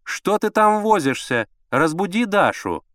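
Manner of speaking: high, slow, insistent call; drawn-out impatient woman; nagging wife. A narrator's reading. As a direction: nag him from the bedroom.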